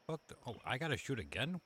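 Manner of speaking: usual speaking voice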